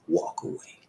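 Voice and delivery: low whisper; whispered advice. Real, not acted. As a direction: lean in and whisper it. Quiet punchline advice.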